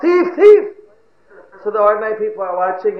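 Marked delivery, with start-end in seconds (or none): 0.0-0.7 very high, loud shout; yelling out. Acted. Thief running ahead pretending to chase, yelling out. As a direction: yell it ahead as if chasing. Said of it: "he's still yelling out".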